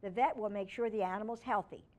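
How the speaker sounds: ordinary delivery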